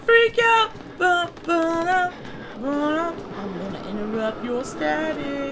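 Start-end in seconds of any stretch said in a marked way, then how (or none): 0.1-3.1 high, loud panicked yelling; mock freak out. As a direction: squeal it like panicking creatures. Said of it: "They're gonna be like".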